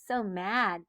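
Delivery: plain reading voice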